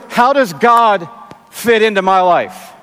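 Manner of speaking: usual speaking voice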